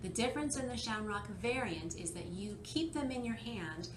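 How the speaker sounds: ordinary delivery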